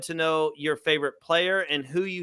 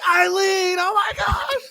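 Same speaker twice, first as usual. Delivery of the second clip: high, loud fan scream; mock scream; 4 dB louder, slower than usual. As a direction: pretend to scream on spotting an idol. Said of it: "I'm going to scream".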